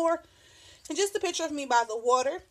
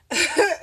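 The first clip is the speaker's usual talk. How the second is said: a quick laugh, louder and higher; short laugh; 5 semitones higher, 6 dB louder than usual. Real, not acted. Vlogger laughing at her crude quote, quick loud laugh. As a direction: laugh at the joke you just read.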